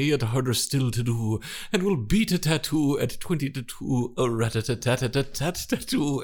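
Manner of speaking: rapid rhythmic recitation, rising high; tongue twister recital. Shown as a demonstration. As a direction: rattle off the twister. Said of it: "a few tongue twisters".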